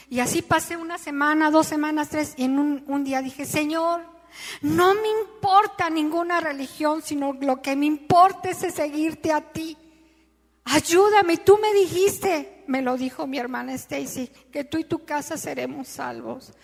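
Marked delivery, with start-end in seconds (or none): 3.4-12.6 pleading prayer. Acted. Desperate prayer to God. 14.5-16.5 pleading prayer. Acted.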